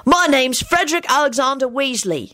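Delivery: put-on high-pitched boy's proud introduction. A narrator's reading. Boy introduces himself to Timothy, proudly. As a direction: announce your full name with pride. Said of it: "he said proudly".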